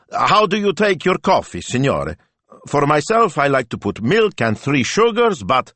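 high, loud, chattering call. A narrator's reading. Shop owner calls from back room to butcher, nervous. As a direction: call nervously over your shoulder. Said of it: "calling over his shoulder".

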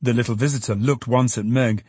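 ordinary delivery, nothing marked

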